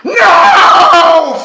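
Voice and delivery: huge high shriek of horror; a piercing shouted no; yelled. A narrator's reading. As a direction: scream it in pure terror. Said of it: "Fred yelled".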